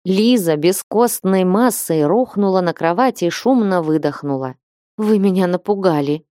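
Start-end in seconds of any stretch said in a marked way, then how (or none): none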